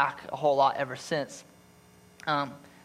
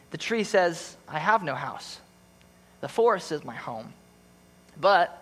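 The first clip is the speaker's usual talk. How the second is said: story narration; 4 semitones higher than usual. A narrator's reading. Pastor reading The Giving Tree to church, tender storytelling. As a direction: read it like a bedtime story to the congregation.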